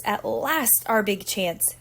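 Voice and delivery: plain reading voice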